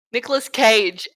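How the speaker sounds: usual speaking voice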